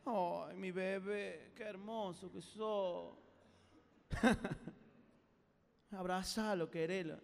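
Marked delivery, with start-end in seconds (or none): 0.1-3.2 put-on low, slow baby-talk adoration; ternura melosa. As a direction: coo drawn-out affection at a taunter. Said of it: "Vos tenés que decirle". (ternura melosa y lenta)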